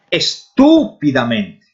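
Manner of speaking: Estúpidamente said slowly, stress on TÚ; Spanish pronunciation. Shown as a demonstration. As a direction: model Estúpidamente in Spanish, es-TÚ-pi-da-men-te. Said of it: "olha essas palavras". (Estúpidamente devagar, tônica no TÚ)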